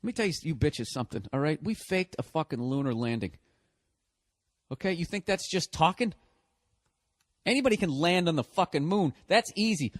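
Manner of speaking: normal talking voice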